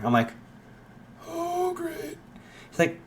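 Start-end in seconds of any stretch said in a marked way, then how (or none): 1.3-2.2 dismayed aside. Acted.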